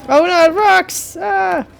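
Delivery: panicked yelps. Real, not acted. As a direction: yelp as you crash. Crashing into rocks in game.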